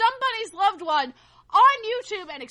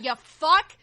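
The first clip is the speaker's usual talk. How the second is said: usual speaking voice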